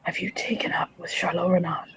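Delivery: low-voiced, half-whispered accusation; hushed confrontation. Brother figure questions her about Charlot Renard, hushed. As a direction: ask it softly, under the breath. Stern in-character confrontation of Devin.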